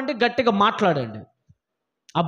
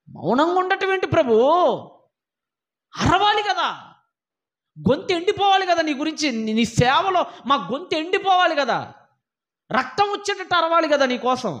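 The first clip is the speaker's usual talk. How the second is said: high-pitched, impassioned objection; insistent, high-pitched appeal to God; pleading protest; 5 semitones higher than usual. Acted. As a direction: plead your case to the Lord, pitch raised.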